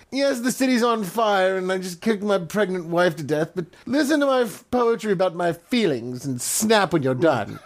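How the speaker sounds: hammy self-important poet; mocking Nero.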